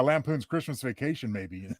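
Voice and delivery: ordinary talk, nothing marked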